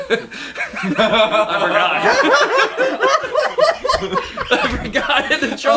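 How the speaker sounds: drawn-out words breaking into high laughter; cracking up at his own mistake; laughing. Real, not acted. Recruit retelling his blunder, laughing hard.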